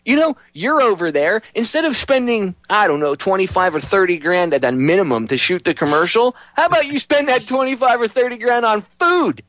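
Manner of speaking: high-pitched, exasperated telling-off; fed up, scolding an absent pitchman; indignant rant. Acted. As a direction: rant upward in comic outrage. Mock rant at charity pitchman.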